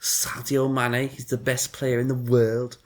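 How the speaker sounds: squeezed, squeaky Scouse impersonation; tense up and squeak out mock Scouse; Scouse accent. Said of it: "anyone that speaks in a Scouse accent".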